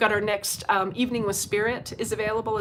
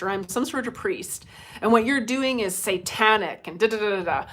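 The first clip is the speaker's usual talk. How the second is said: normal talking voice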